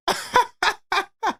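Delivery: high-pitched burst of laughter; laughing. Real, not acted. Streamer cracks up after McCain's three, laughing. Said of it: "The only reason I'm laughing".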